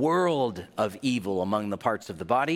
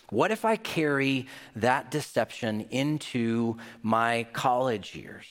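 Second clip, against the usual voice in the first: ordinary talk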